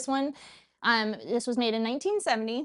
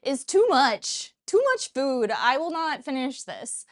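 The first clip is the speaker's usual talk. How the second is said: high, slow overwhelmed whine; dramatic complaint; 4 semitones higher, 4 dB louder, slower than usual. Retold or imitated. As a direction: ham up being overwhelmed. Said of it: "I was like".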